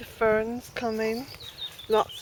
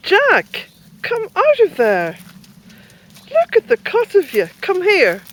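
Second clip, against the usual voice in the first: loud, much higher scolding call; calling the dog; 10 semitones higher, 11 dB louder than usual. Real, not acted.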